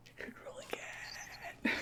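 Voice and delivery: very soft, slow whisper; barely audible conspiratorial whisper; sneaky whisper. Pretend. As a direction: whisper a sly aside, drawn out. Sneaky aside about rerolling.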